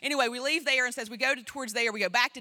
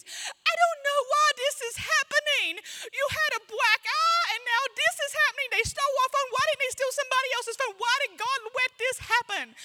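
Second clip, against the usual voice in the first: put-on high, wailing child's voice near tears; upset little girl; 14 semitones higher than usual. Seven-year-old daughter melting down at the mall over the lost phone, high wailing child voice. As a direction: play the seven-year-old in full meltdown, voice pitched way up. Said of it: "she goes".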